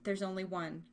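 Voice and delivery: ordinary delivery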